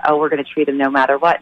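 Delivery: normal talking voice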